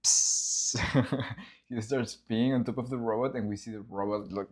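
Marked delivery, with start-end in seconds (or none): 0.0-0.8 unvoiced hissing psst; pee hiss. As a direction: hiss like a thin stream. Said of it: "we start hearing".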